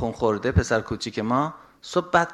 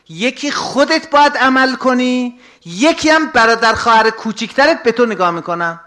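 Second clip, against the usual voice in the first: forceful preaching; 7 semitones higher, 8 dB louder than usual. Acted. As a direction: drive each point home to the congregation. Preacher lectures on being example.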